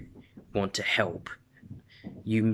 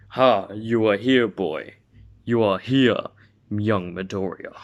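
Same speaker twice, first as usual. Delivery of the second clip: hearty hero voice; 4 dB louder than usual. Acted. All Might voiced in story.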